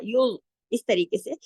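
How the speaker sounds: यो said with a full, rounded mouth; full-mouthed heavy letter. Shown as a demonstration. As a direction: fill the mouth on यो. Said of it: "पूरा मुंह भर के पढ़ा जाता है". (मुंह भर के भारी आवाज)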